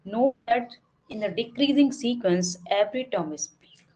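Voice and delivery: slow, even reading of a theorem; slow textbook reading. Acted. Teacher reads theorem to students slowly. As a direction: read each word out slowly for the class. Reads note aloud from text.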